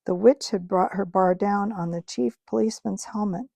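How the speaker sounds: usual speaking voice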